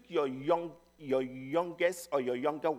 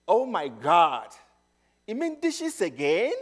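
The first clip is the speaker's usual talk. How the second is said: mock-groan at one more chore; exasperated teen; 6 dB louder than usual. Teenage daughter complaining to her parent about dishes, exasperated.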